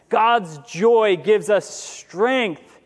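very slow, weighted phrase; preacher drives the point home, very slowly; slow emphatic preaching. Acted. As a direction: let each word land.

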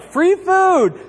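high mock cheer; excited crowd. People who followed Jesus for bread, mock excitement. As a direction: whoop it like a delighted follower.

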